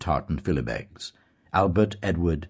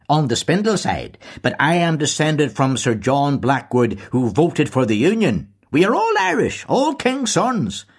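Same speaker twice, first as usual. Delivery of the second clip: much higher, louder, slowing at the end; proud old Tory; 10 semitones higher, 7 dB louder than usual. A narrator's reading. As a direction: swell with pride in the lineage. Deasy boasts of ancestry.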